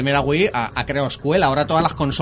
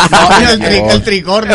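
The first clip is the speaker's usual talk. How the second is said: laughing; 7 semitones higher, 12 dB louder, slower than usual. Real, not acted. Laughing outburst about controller.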